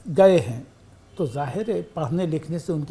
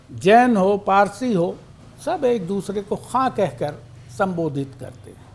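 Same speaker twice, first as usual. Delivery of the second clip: ordinary talk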